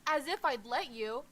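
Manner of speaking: enraged shout. Acted. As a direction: snap back in a rage. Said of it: "Kamo is instantly enraged".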